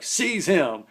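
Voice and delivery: high, loud order to his crew; commanding shout. Retold or imitated. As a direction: snap the command like a boss. Gang leader giving an order.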